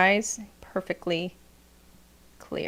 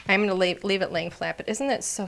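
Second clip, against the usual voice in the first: ordinary delivery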